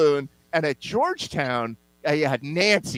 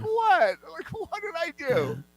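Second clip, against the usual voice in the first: high, softened innocent protest; mock bewilderment; 12 semitones higher, 5 dB quieter, slower than usual. Acting out baffled reply to haters.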